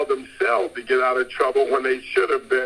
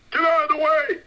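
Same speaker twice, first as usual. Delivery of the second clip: mock scream; 13 semitones higher than usual. Preacher mimicking shoppers screaming at him, high mock yell. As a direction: shriek it like a pushy shopper. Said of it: "When they start screaming at me".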